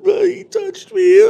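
very high, loud mock wail; fake bawling. Coworker mimics his fake crying once the door shuts, loud mock wail. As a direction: overdo the crocodile-tears cry. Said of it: "he goes from".

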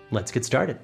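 ordinary delivery, nothing marked

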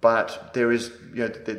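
ordinary delivery

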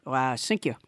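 very low, soft puppet thanks; French Trump puppet. Pretend. Puppet Trump thanks the host for the beret, very low and soft. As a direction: drop deep and quiet to thank. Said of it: "French Trump".

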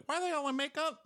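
high, incredulous mock question; mock bafflement. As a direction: play it baffled. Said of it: "I was like".

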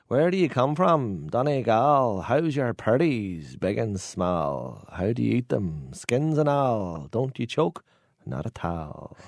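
slow sing-song recital; schoolroom poem. Acted. Man reciting a potato poem learned at school, sing-song. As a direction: chant it in drawn-out rhyme, pitch rising and falling. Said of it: "So the poem was".

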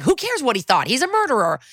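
high-pitched huffy retort; indignant outburst. Retold or imitated. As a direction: voice jumps high and sharp. Retold angry outburst.

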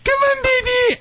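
very high sweet call; nicely called. Shown as a demonstration. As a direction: coax her back brightly. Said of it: "where you nicely say".

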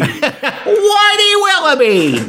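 high, slow shouted announcer call through laughter; high laughing mock announcer. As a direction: belt the name like a ringside announcer, laughing. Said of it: "they did speak that way".

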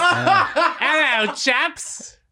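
high, hammed-up British chap; loud caricature of a jolly Englishman; mock British accent. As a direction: greet them in a ridiculous British voice. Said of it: "they didn't actually speak like that".

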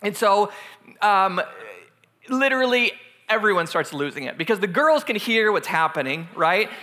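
ordinary delivery

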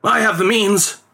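a hint of a knowing father; father replies. A narrator's reading. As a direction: answer with a showman's assurance. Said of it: "replied his father".

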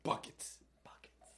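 breathy whispered word. Acted. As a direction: whisper it like a secret. Whispered bit.

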